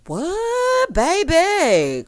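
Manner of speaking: high, loud, drawn-out disbelief; scandalized high-pitched outburst; re-enacted shock. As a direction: squeal it in mock shock. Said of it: "The way I said".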